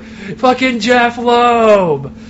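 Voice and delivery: slow, loud curse through the teeth; drawn-out angry shout. Retold or imitated. Telling his girlfriend who to blame, a slow furious curse. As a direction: drag the name out in disgust. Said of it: "I was like".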